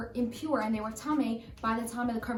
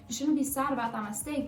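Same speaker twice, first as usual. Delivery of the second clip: ordinary delivery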